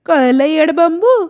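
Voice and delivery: wife scolding her husband, teasing and loud. Acted. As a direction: tease him sharply while twisting his ear. Wife scolds, twisting his ear.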